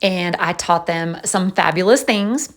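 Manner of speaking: ordinary talk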